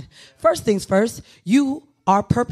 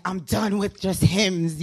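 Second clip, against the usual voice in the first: put-on slow, lower, a touch of a bored teen; teen rebel attitude; 4 semitones lower, 4 dB quieter, slower than usual. Recalling her nineties self, lightly voiced bored teen. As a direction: drawl it like a fed-up kid. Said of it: "my first outbreak of, like".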